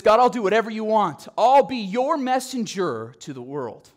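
loud, keen offer to serve; loud eager Isaiah volunteering. Acted. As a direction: jump at the chance to serve.

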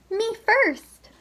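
high, loud "Me first!"; shrill piggy cry; eager cry. A narrator's reading. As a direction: yell it while running ahead. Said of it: "cried Pinkerton".

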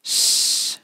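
a long hissed "Sh" with no voice; drawn-out unvoiced "Sh"; sh sound. Shown as a demonstration. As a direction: hiss out "Sh" like hushing someone. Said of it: "and it's sh".

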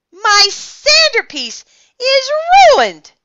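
high, loud wail of dismay; distraught yell; anguished. A narrator's reading. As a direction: cry out over the ruined creation. Builder's sandcastle has just been crushed.

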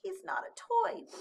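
high, hurt little penguin; mildly tearful objection; sniffly protest. A narrator's reading. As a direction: protest in a small plaintive voice. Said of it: "sniffed Flip".

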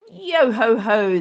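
loud jolly pirate chant; dancing pirates. Acted. Pirates celebrating with a jolly loud chant. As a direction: sing out as they dance. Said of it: "the pirates dance".

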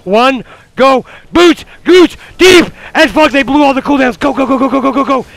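urgent yelling to the team; shouted callout. Real, not acted. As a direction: yell the go call. Shotcalling a team fight.

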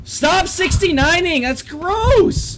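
high, loud scream of alarm; startled shriek. Real, not acted. Player grabbed by the monster, high startled shouting. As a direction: shriek in shock, drawing it out. Jump scare in horror game.

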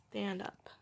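soft unvoiced whisper. Real, not acted. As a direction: breathe it to the cat. Whispering to cat.

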